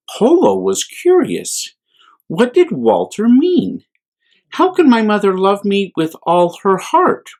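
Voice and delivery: gentle, lively bedtime reading voice; children's story reading. A narrator's reading. A priest reading a picture book to children, gently. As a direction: read it to little ones with wonder.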